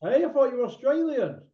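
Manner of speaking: high-pitched surprise at being mistaken; surprised. Real, not acted. Host learns caller is from Leicester, surprised. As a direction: pitch jumps up in real surprise.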